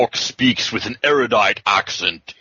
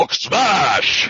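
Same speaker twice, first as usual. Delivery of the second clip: dumb orc; 8 semitones higher than usual. Pretend. Riffing on dumb orcs, hammed-up orc shout. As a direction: bellow it like a dim brute, played for laughs. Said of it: "that Jethro orc who talk like this".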